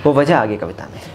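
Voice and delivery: ordinary delivery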